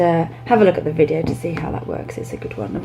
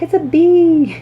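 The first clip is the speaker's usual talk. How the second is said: put-on child's answer; 11 semitones higher, 5 dB louder, slower than usual. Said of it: "they'll be like".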